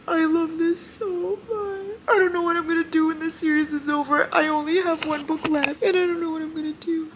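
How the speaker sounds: high, crying voice; sobby and very high; tearful wail. Real, not acted. Crying over series nearly ending.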